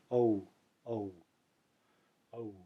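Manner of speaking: quiet o plus u glide; Sanskrit au. Shown as a demonstration. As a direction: say O gliding softly into U. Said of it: "come up with the correct pronunciation".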